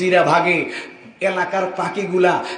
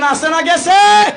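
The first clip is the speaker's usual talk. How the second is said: higher, louder shout of গেছে; loud call to the crowd; 8 semitones higher, 6 dB louder, slower than usual. Real, not acted. Preacher asks the crowd louder whether their voice is gone. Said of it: "জুড়ে বলেন".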